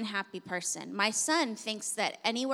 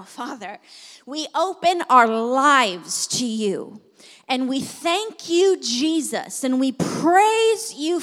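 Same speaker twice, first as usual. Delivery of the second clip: slow, rising, louder preaching prayer; fervent prayer; 5 dB louder than usual. Acted. Pastor praying aloud over the congregation, building fervor. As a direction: pray over the room with growing force.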